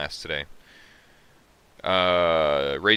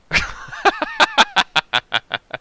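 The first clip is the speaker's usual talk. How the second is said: real laugh; 7 dB louder than usual. Real, not acted. Cracking up at the movie.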